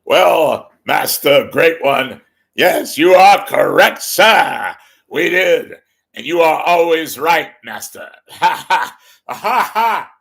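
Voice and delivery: booming, high-pitched fawning sidekick; Ed McMahon. Acted. Sidekick answering his master, loud hammed-up yes-man voice. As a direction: bellow agreement and finish with big hearty laughs. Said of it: "saying goodnight to Ed McMahon".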